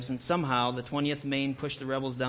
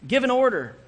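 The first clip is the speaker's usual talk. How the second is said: pleading call, higher and louder; urgent plea; 8 semitones higher, 6 dB louder than usual. A narrator's reading. Brother begging Joshua to act, a high urgent plea. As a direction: push him to act, voice jumping up.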